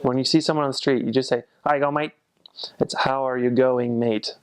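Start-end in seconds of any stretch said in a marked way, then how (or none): 1.6-2.1 Australian greeting run into one sound, pitched up; Aussie accent. Shown as a demonstration. Greeting someone on the street, in Australian accent. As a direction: slur "how are you going, mate" Aussie-style. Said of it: "accent tips".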